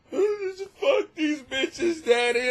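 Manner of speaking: high, slow little dog voice. Pretend. Tiny Chihuahua tells her daddy off, high and slow. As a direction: voice the tiny dog as a sassy child. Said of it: "You ever think the dogs could talk".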